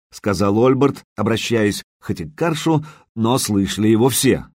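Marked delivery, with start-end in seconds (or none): none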